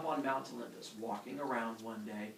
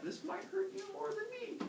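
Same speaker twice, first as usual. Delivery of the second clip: very high, softer aside; sheepish warning; 6 dB quieter than usual. Acted. Hephaestus warns Zeus before the axe blow, high small voice. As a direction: slip in the warning in a tiny voice.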